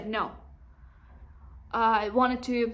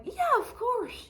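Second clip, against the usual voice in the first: high, slow, sugary eager yes; mock overeager agreement, way up high; eager yes-man; 12 semitones higher, slower than usual. Eager people-pleaser self quote.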